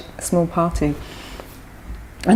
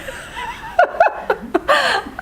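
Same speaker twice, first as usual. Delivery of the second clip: high laugh. Real, not acted. Laughs at how it got worse.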